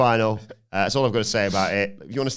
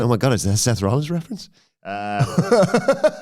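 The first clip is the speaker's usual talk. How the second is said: ordinary talk, nothing marked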